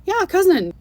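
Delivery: high, loud delighted cry; light up as he places the cousin; elder's joyful high recognition, imitated; excited recognition. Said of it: "He's like".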